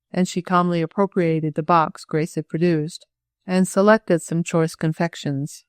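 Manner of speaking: ordinary delivery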